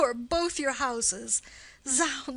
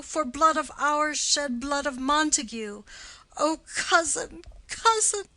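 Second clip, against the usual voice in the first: higher and louder lament of a grieving aunt; keening grief; 4 semitones higher, 4 dB louder than usual. Acted. Grieving aunt wails over her slain nephew to the prince, keening. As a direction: wail for the dead boy and demand vengeance.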